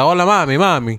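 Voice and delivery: mock Spanish flirting. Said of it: "he be like".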